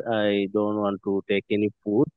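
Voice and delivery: ordinary talk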